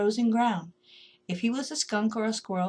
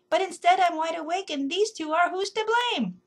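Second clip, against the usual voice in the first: high, louder indignant worm; a worm fed up and blaming; exclaiming worm; 9 semitones higher, 4 dB louder than usual. A narrator's reading. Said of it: "Rupert heard the worm exclaim".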